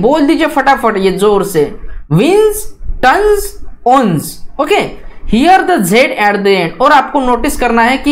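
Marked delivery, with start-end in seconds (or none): 2.1-4.5 विंस, टन्स, ओन्स said clearly with a buzzing Z; said aloud. Shown as a demonstration. Teacher reads the three words aloud for students to copy. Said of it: "आपको ये तीन words जोर से बोलने हैं".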